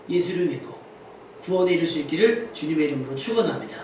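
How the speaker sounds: ordinary delivery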